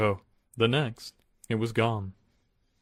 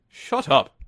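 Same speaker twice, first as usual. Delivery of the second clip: burst out; 5 semitones higher, 6 dB louder than usual. Acted. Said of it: "burst out Bear".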